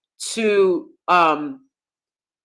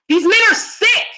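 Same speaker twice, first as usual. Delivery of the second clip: outraged outburst; 11 semitones higher, 4 dB louder than usual. Real, not acted. Condemning abusers.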